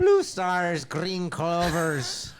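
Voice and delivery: high, loud, drawn-out Irish chant; mock Irish leprechaun. Guest parodying cereal mascot, high Irish voice. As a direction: list them like a cartoon leprechaun.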